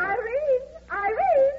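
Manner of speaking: high excited call across the room. Acted. Arriving guest calls out to her hostess, excited high call. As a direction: sing out her name on arrival.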